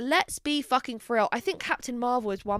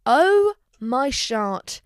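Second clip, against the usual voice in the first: loud, very slow exclamation; drawn-out mock astonishment; exaggerated awe; 7 dB louder, much slower than usual. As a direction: stretch it out in hammed-up amazement. Gushing over a rewatched film.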